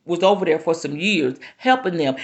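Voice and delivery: ordinary delivery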